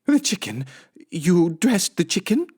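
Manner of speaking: high, rising disbelief; incredulous. Acted. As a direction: ask it aghast. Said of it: "asked Missus Rogers".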